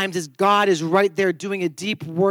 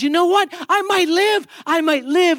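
excited little bird; 9 semitones higher than usual. Acted. Pastor voicing the thawed bird in his sermon joke, high and thrilled. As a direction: chirp it out like a bird that just found it will live. Bird rejoices it can live.

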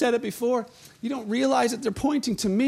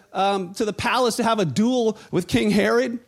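normal talking voice

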